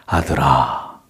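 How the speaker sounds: low, tender fatherly address. Acted. Father addressing his beloved son, tender. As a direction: speak lovingly, voice dropped low. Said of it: "다정한 표현입니다".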